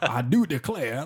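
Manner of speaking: slow, laughing fake Southern drawl; mock Southern accent. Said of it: "to do a fucking Southern accent".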